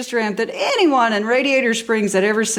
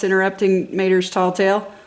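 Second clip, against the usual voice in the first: ordinary delivery, nothing marked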